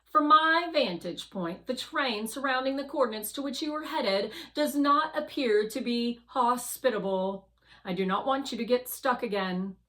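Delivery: Guardian warning. Acted. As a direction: warn the rover off the rough terrain. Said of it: "Guardian says".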